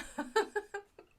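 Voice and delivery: high laugh. Real, not acted. Laughing at rolling down the hill.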